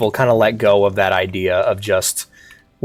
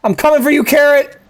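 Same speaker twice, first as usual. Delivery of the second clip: desperate call; 13 semitones higher than usual. Real, not acted. Chasing the lost carrot.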